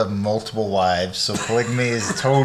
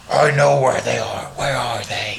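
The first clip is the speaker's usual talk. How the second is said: Batman voice spoken into a cup. Acted. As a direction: growl it into a cup. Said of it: "Stop speaking into my cup".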